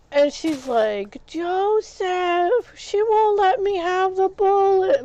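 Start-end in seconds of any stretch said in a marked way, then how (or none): 1.3-5.0 much higher and louder, a put-on tattletale voice; mock tattling voice. The woman complaining to Joseph about the blender, mock tattling. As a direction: imitate her running to tell on you.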